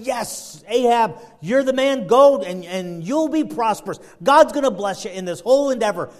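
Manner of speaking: loud, high cheerleading cry; acted yes-men hyping up the boss; prophets' rousing endorsement. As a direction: egg the king on with gusto. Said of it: "And they're all saying".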